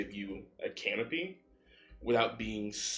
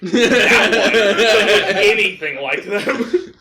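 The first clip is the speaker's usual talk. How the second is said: high, very loud, slow exclamation; bewildered outburst; 11 semitones higher, 18 dB louder, much slower than usual. Acted. Game master voices the confused Rugvir, loudly bewildered. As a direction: cry it out over laughter.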